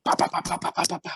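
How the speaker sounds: high, punchy run of bah-bah-bah; rapid "bah" noises. Retold or imitated. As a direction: imitate frantic crawling with quick bahs. Imitating fast high crawl in mud.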